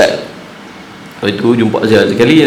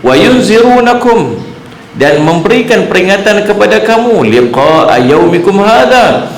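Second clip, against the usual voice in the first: fervent preaching; 9 semitones higher than usual. Acted. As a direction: preach it with full force to the congregation. Preacher at full force. (berceramah dengan penuh semangat, nada tinggi)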